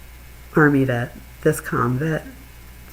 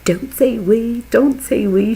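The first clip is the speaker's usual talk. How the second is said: mock yell, pitched up in indignation; retold yelling; 6 semitones higher, 5 dB louder, slower than usual. Woman scolding the husband in line, yelling. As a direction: imitate an angry woman, higher and louder. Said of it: "she starts yelling at him".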